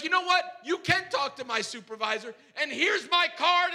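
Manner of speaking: high, heated mock outburst; building to a froth. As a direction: act out losing it, pushed high and loud. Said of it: "I am just building to a total froth".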